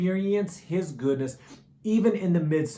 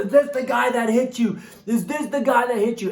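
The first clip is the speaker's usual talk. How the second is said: higher, louder fierce demand; angry dad; 6 semitones higher, 5 dB louder than usual. Retold or imitated. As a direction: confront him while staring at your son. Angry dad grabbing the attacker.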